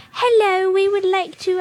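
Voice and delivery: high-pitched put-on lady voice; voix de la dame. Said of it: "you gave her a voice".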